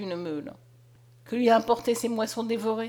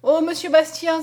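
loud, raised plea; pleading cry; 5 semitones higher, 7 dB louder than usual. A narrator's reading. Mother begging the soldier Bastien, loud and pleading. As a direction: beg the brave soldier. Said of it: "s'écria Julienne".

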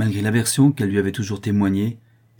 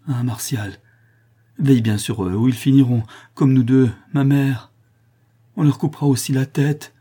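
raised, anguished warning; moaning plea; 5 semitones higher, 5 dB louder than usual. A narrator's reading. Condemned sister to brother, moaning plea. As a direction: beg with growing dread. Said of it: "en poussant de sourds gémissements".

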